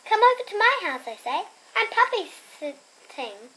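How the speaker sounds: plain reading voice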